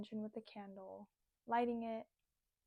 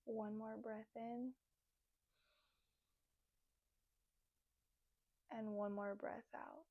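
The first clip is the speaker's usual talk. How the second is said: soft, unhurried meditation voice with long pauses; guided meditation; 7 dB quieter than usual. Acted. Leads guided breathing meditation.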